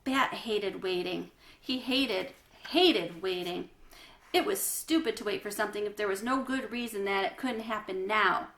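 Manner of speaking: usual speaking voice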